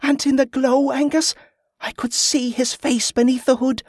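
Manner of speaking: put-on high-pitched girl full of wonder; high, rapturous girlish telling; awestruck young Molly. A narrator's reading. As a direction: marvel in a high child's voice.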